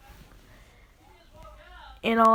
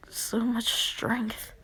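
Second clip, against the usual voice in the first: slow, breathy amazement; stretched-out airy awe; awed; 4 dB quieter, much slower than usual. Acted. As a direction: draw it out in a hushed, whispery marvel. Retold kid feels new power.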